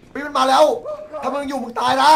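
high, loud panicked warning; panicked yell. Real, not acted. Monster approaching, panicked warning.

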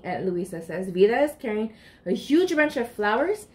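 usual speaking voice

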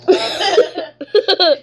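loud burst of laughter. Real, not acted. Laughing at the face reveal.

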